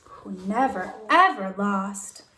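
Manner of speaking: ordinary delivery, nothing marked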